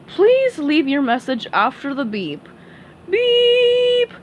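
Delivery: recorded-message voice then high beep; mock answering machine. Patrick fakes a voicemail for Sandy, mock answering machine. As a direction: pretend to be a voicemail.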